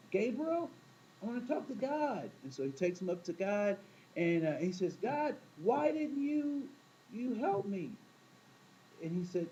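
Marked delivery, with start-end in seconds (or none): 0.1-2.3 higher, pleading call to Gabriel; drowned man demanding to see God, raised pitch; indignant demand. Retold or imitated. 5.0-7.9 high, slow, whiny why; drowned man complaining to God, slow and high; aggrieved complaint. Retold or imitated.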